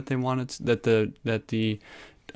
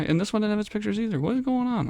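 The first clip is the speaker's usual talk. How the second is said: high exasperation; 11 semitones higher, faster than usual. Real, not acted. Pictures missing again.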